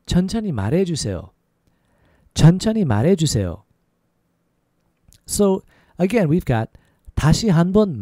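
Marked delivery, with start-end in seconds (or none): 0.1-1.3 syllables linked in one smooth run; a little faster. Shown as a demonstration. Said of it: "A little faster". 2.3-3.6 syllables linked in one smooth run; a little faster. Shown as a demonstration. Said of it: "A little faster".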